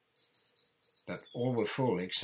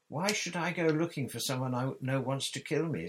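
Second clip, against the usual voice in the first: higher, louder baffled question; blank puzzlement; 7 semitones higher, 7 dB louder than usual. A narrator's reading. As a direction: ask as if it makes no sense. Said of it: "said Harry blankly".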